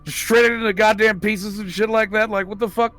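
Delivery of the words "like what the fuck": ordinary talk, nothing marked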